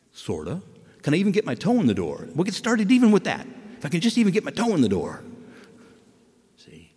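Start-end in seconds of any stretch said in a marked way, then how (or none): none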